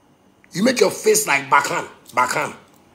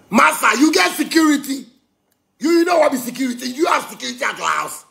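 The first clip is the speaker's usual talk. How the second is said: angry shout; 7 semitones higher than usual. Acted. Yelling back at the arguer.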